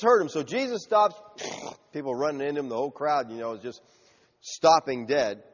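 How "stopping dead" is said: ordinary talk, nothing marked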